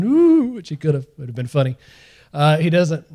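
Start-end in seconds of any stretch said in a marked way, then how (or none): 0.0-0.5 high, loud spooky ooh to scare them; spooky ghost ooh. Pretend. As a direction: wail like a ghost for fun. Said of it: "Instead of saying".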